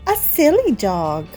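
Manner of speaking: ordinary delivery, nothing marked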